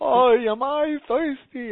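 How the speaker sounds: hammed-up New York kvetch, stretched out; New York accent. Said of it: "as a New York accent".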